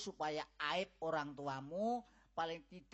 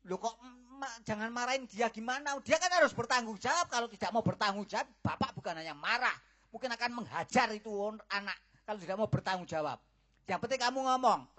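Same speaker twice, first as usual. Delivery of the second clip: loud, higher and fast; angry rant; 5 semitones higher, 5 dB louder, faster than usual. Acted. Father angrily insists the boy must take responsibility. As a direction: rail at her, barely pausing.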